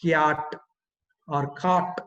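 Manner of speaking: CAT turned into "kyaat" or "kaat"; mother-tongue spelling pronunciation. Shown as a demonstration. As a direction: say CAT as transliterated, with a long 'aa'. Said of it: "we would end up writing".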